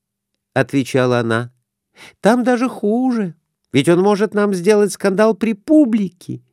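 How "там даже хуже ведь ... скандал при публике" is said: high anxious woman's voice; worried wife. A narrator's reading. Wife nervously answering her husband about the prosecutor. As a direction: fret over the prosecutor, voice raised high.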